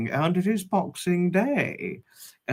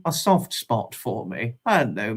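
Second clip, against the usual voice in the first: ordinary talk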